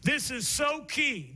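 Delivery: normal talking voice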